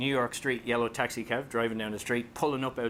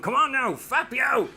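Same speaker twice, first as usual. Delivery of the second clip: higher, louder hammed-up Dublin street yell; mock angry shout; 12 semitones higher, 7 dB louder, slower than usual. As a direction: bark it like a furious driver, for comic effect. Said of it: "That sounds a bit angry".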